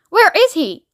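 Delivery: put-on high, childish insistence; impatient demand. President demanding the chief interpreter, high impatient question.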